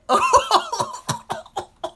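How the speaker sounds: high, loud burst of laughter; delighted laugh. Real, not acted. Fan cackling at Jack's comeback to his mother. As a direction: cackle with glee at the burn.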